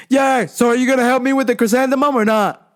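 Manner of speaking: pushy mock deaf man's voice; yelling deaf customer. Deaf customer presses busy store worker for help, imitated yelling. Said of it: "he's yelling back at me".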